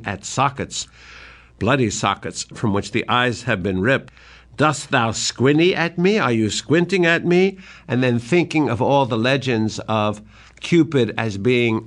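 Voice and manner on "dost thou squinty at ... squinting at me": higher, teasing jeer; mocking taunt. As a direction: jeer at him like a madman. Mad Lear taunting.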